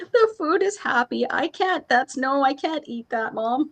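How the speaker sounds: put-on high child voice pleading; a kid begging her mom not to eat it; squeaky pleading child; little girl. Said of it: "niece refusing happy food".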